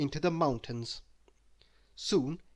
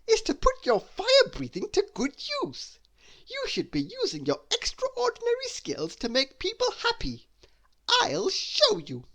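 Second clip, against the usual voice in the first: higher, louder teaching voice; Mister Tickle setting the dragon straight; firm character voice; 3 dB louder than usual. Acted.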